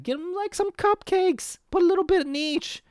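high-pitched, silly put-on voice; high mock voice. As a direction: pitch it way up, playfully. Acted advice, jumps into high voice.

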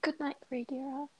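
high, very soft farewell; soft goodnight. Acted. Timid girl bidding her friend good night, very soft and high. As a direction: murmur good night gently.

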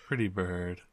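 soft, strange cooing at a pet. Acted. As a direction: coo it like talking to a parrot. Player coos while petting someone's head.

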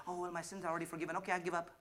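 fast, dismissive mock excuse. Pastor mocking a believer who gives up fast. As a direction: toss it off like a lazy believer.

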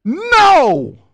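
loud, high, drawn-out "No!"; emphatic exclamation. Shown as a demonstration. Preacher spelling out the strongest negation, emphatic exclamation. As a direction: cry "No" as if in all caps. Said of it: "all kinds of exclamation po".

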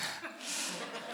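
very soft unvoiced chuckle; a sheepish breath of a laugh; barely audible chuckle; tiny breathy laugh. Real, not acted. Laughs at own excuse.

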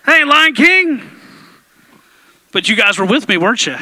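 ordinary delivery

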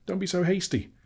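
high-pitched squeak of protest. A narrator's reading. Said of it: "squeaked Bilbo".

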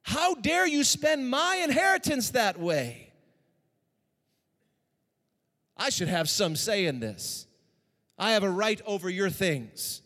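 slow, high, loud outrage; furious son. Acted. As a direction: throw the accusation at the father. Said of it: "He's angry".

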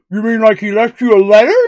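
talking through a mouthful; words round a bite of food; mouth full. Acted. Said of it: "with a mouthful of probably bacon".

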